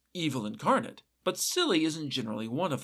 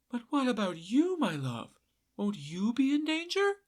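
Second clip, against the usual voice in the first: high, soft, fretful voice; pleading for her husband, voice lifted high; worried wife; 7 semitones higher, 3 dB quieter than usual. A narrator's reading. Wife fearing for her husband, high and soft.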